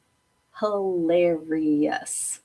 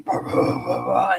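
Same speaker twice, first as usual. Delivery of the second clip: mock puppy bark. Imitating the puppy chasing down the hall barking "bah". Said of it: "she will chase you down the hall now going".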